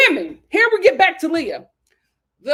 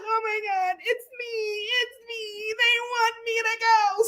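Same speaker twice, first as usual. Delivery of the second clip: mock wailing Janae; 8 semitones higher, 4 dB quieter than usual. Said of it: "She is like".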